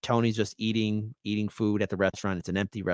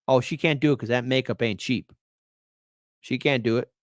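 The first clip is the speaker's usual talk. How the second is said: ordinary talk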